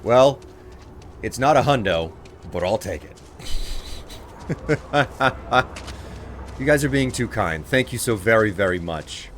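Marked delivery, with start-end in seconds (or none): 3.4-6.5 breath-led run of chuckles. Real, not acted. Streamer accepting a gift from chat, chuckling. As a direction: chuckle in short, airy bursts. Amused by the donations.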